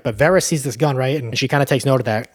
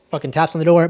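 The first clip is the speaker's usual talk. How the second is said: ordinary talk, nothing marked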